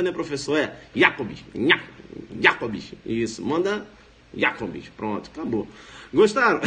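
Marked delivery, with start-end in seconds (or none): 0.9-2.9 low "Jacobs", YA bitten off; biting pronunciation. Shown as a demonstration. Teacher likens the name to a bite, biting pronunciation. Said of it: "uma mordida". 4.3-4.9 low "Jacobs" as YA-cobs; biting pronunciation. Shown as a demonstration. Teacher gives the final model, biting pronunciation. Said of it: "uma mordida".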